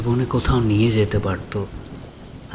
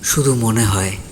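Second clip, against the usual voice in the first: usual speaking voice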